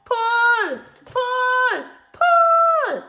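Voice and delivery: high, loud, rising yells; building shouts of pull; repeated yells. Acted. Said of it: "yelled Mugglewump".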